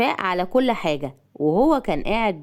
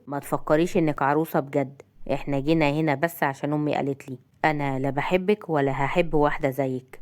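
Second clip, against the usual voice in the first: low, cool and detached; icy indifference, pitched low; coldly; 6 semitones lower, 4 dB quieter than usual. A narrator's reading. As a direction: put her down coldly in a lower voice. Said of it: "قال لها ببرود".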